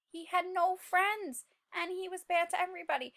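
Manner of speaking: usual speaking voice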